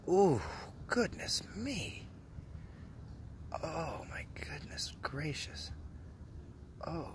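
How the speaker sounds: breathless whispered awe, drawn out slow; awed whisper. Real, not acted. Vlogger gawking at a sunbathing woman, awed whisper. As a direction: whisper it like you can't believe your eyes.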